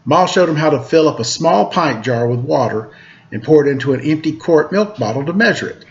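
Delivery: plain reading voice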